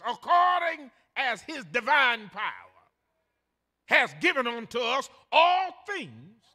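loud, slowing toward the end, like reading from the pulpit; scripture reading. Acted. Preacher reading the third verse to his congregation, loud and solemn. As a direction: proclaim the verse with weight.

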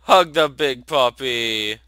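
loud, slow, drawn-out gushing; big slow cheer over a pet; eager urging. Real, not acted. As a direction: urge it on, stretching the words loudly. Urging character to hug puppy.

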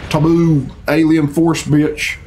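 ordinary talk

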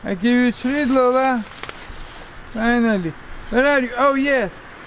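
high, loud coaxing calls; calling a dog. Real, not acted. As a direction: coax the dog out with a treat. Calling hidden dog with treats.

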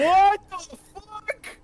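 high, loud, drawn-out disbelieving cry; shocked outcry. Real, not acted. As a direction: wail it out in shock. Loses, cries out in shock.

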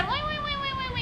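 tiny squeaky cries, very high and soft; squealing mosquito. Acted. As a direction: squeak like a swatted bug. Mosquito squealing as it's swatted. (ઝાપટ ખાધેલા મચ્છરની ઝીણી ચીસ)

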